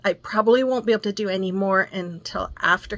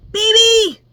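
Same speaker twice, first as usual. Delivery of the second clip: put-on high, loud little-boy call from bed; toddler calling out; 14 semitones higher, 11 dB louder, slower than usual. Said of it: "I would hear him in his bed go".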